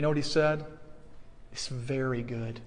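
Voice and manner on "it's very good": low, very soft delivery of the quote; hushed reveal. Retold or imitated. Preacher reveals what God said after mankind was made, hushed. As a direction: drop right down in pitch and volume.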